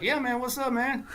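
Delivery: high-pitched, laid-back imitation; easygoing retold greeting, high; casual. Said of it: "like, casually".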